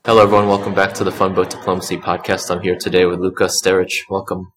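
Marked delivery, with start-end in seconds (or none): none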